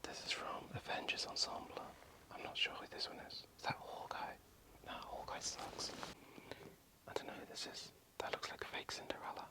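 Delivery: hushed whisper, sneaking around; breathy secret whisper. Real, not acted.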